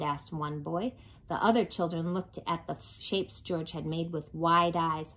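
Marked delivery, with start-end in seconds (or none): none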